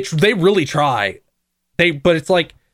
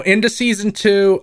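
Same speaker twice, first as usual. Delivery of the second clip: ordinary talk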